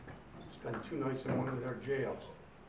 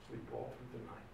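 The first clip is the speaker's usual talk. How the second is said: ordinary talk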